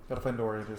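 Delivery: ordinary talk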